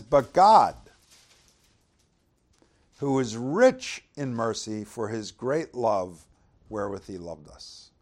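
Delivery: reading Ephesians to the church, proclaiming; scripture reading. Acted. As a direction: proclaim the verse, leaning on God. Reads chapter two verse four.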